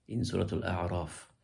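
ordinary talk

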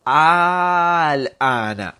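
Al-ana, very slowly, with the first aa held long; stretched aa sound. Shown as a demonstration. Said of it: "Rather it be". (slow demo of the elongated aa in Al-ana)

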